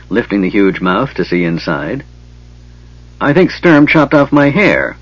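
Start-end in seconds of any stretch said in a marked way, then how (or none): 3.2-5.0 higher, hammed-up mock wail; a light comic wail of dismay. Trapped Kender wailing to Tanis about his hair. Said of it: "the Kender wailed".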